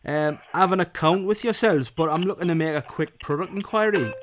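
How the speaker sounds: put-on Geordie accent. Prank caller to the shop clerk, putting on a Geordie accent. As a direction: Geordie builder on the phone, chirpy. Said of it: "Now you're Geordie".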